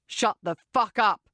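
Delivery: rough, forceful male character; angry order. A narrator's reading. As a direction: order him harshly to be silent. Adam slaps and orders the man.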